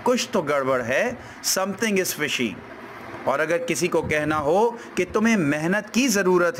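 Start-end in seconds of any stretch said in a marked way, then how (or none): none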